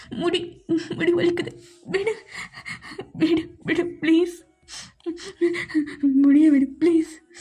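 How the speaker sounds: hurting, gasped short pleas; pained pleading. Acted. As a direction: beg to be let go as hair is pulled. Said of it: "வலிக்குதா என்ன? ரொம்ப வலிக்குதா?".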